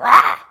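loud, high burst of a roar; sudden scare growl. Retold or imitated. Preacher reenacts scaring the dog, loud roar. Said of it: "and I'd go".

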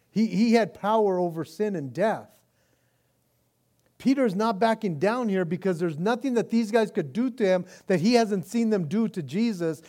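loud, high, driving sermon voice; full-force preaching. Acted. Pastor preaching Peter's courage to the congregation, booming. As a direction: preach it out with rising fire.

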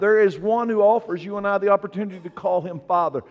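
full-force preaching. Acted. Pastor proclaiming Jesus to the church, full-force preaching. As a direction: drive it home to the congregation.

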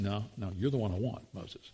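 gentle firm God voice; calm God. Retold or imitated. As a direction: settle him quietly. God answering Moses gently.

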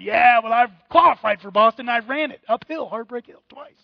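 loud bragging about Boston; boastful runner. Retold or imitated. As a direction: show off the marathon, loudly. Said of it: "He's like".

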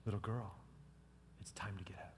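hushed and tender; gentle whisper. Acted. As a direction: whisper "Little girl" softly. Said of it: "just like he's waking someone up from sleep".

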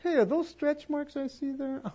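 high, catty mock sweetness; sarcastic. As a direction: needle her with fake concern. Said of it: "could have been sarcastic and said".

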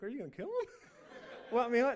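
high, laughing, alarmed question; mock-alarmed little brother; half-laughing fright; scared kid. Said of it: "Then I got scared".

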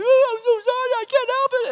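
very high, loud mock cry; hammed-up falsetto blubbering; wailing daughter. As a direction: imitate a child wailing an apology. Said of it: "and Tabitha's like".

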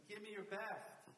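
retold insistent shout of encouragement; urging coach. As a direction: push him hard, imitating a coach. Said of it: "And he says".

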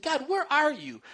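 demanding angrily why God doesn't show up; cranky outburst. Retold or imitated. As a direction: throw the complaint at God like a hurt, cranky child. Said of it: "I started getting really cranky".

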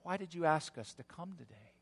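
soft, hushed question; very quietly. Acted. Lawyer to the dying pastor at his bedside, very quietly. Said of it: "very quietly leaning down toward the pastor".

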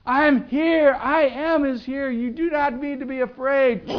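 full-force shout, pitched very high; screaming. Acted. Jesus screaming to the disciples over the storm, very high and loud. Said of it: "He's screaming at them".

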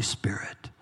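soft whispered final word. Real, not acted. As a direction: let the word fade to a whisper. Sermon point trailing to hush.

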